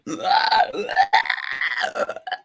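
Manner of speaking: rasping creature screech; monster roar. Acted. Game master voices the creature as the player approaches, raspy roar. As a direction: roar like a beast that has been approached.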